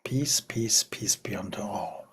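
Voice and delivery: hushed whispered benediction; whispered blessing. Acted. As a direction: whisper peace over the room. Closing blessing of an invocation.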